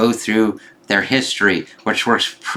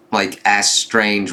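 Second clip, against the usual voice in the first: ordinary delivery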